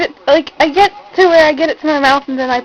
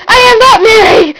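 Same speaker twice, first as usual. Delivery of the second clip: higher and louder, protesting loudly; indignant shout; 8 semitones higher, 7 dB louder than usual. Real, not acted. To her friend, refusing to be called Mary, shouting. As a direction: shout it like you're offended.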